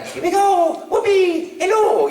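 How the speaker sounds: high, cheerful Italian plumber; Mario voice. Shown as a demonstration. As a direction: bounce it out like Mario. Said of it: "people come by the booth and say".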